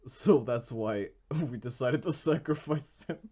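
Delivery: words broken up by real laughter; laughing. Real, not acted. Confessing to friends about sacrificing someone, laughing.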